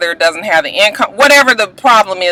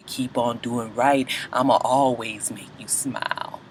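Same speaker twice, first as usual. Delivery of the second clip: much lower, much softer, slow crooning reassurance; 9 semitones lower, 14 dB quieter than usual. Retold or imitated. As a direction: play the smooth-talking boyfriend, low and soft. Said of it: "that's [name] talking".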